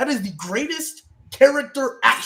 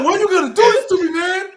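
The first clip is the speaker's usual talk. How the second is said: higher, louder mock sob, laughing; mock crying plea; 11 semitones higher, 6 dB louder than usual. Acting out Jay begging Roman, hammed-up mock sobbing. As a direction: whine it through fake tears.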